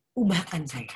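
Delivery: barely breathed plea; a lone prayer, whispered. Retold or imitated. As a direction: whisper it to God. Praying alone to God.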